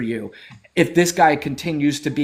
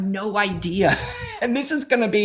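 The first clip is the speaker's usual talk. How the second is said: laughing through it; 4 semitones higher than usual. Real, not acted. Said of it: "gonna laugh my fucking ass off".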